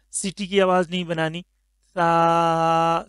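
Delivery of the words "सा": सा said soft and light, drawn out slowly; a light, gentle सा with no whistle. Shown as a demonstration. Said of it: "soft करके".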